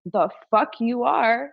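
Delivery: drawn-out, scornful challenge; retold irritated retort; pissed off. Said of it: "I was so pissed".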